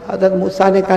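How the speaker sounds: normal talking voice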